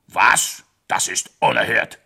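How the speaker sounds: loud indignant outburst; outraged king. Acted. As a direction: bellow your shock.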